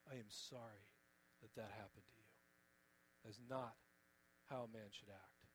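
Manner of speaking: low, soft and solemn; sincere apology. Real, not acted. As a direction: apologise gently from the heart. Sincere apology on behalf of men.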